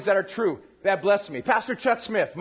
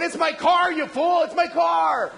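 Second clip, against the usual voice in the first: high, loud mock yell at a friend; exasperated shouting; 8 semitones higher, 4 dB louder than usual. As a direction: yell like a panicked, annoyed driver. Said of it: "driving around, going".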